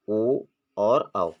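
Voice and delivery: ओ and औ said very slowly and held; slow vowel recital. Shown as a demonstration. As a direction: lay out ओ और औ slowly, each vowel drawn out. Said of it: "यह द्विमात्रिक स्वर या दीर्घ स्वर कहते हैं".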